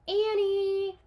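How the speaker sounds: high, loud call; loud call of her name. A narrator's reading. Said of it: "he called".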